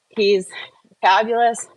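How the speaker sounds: ordinary delivery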